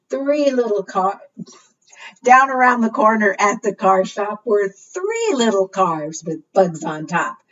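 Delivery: singsong storytime read-aloud; reading to children. Acted. Librarian reads the car rhyme to children, lightly singsong. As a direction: read it out to the little ones.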